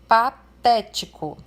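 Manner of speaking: patético said slowly and loudly, the té syllable punched; stressed example word. Shown as a demonstration. Teacher shows students the stress in patético, slow and loud. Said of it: "a sílaba té ali é a sílaba acentuada".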